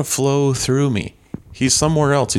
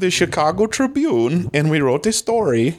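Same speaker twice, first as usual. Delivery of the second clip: higher, lilting mock-Swedish accent; Swedish chef-like accent; 5 semitones higher than usual. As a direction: tell it in a wandering Swedish-ish accent. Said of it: "sounds right now like you're the Swedish chef".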